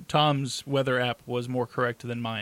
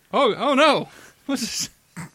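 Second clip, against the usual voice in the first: mock alarm; 10 semitones higher, 8 dB louder, slower than usual. Pen acting possessed.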